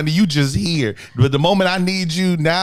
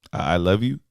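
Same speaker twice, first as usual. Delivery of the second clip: grudging reply; 8 semitones lower than usual. Retold or imitated. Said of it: "Tell me you love me".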